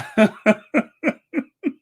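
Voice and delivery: loud, high burst of laughter; laughing. Real, not acted. Host tickled by the palace resignation headline, laughing hard.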